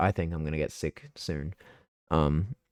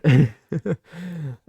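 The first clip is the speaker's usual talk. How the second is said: burst of laughter; 7 semitones higher than usual. Real, not acted. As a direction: crack up at your own story. Laughing at own story.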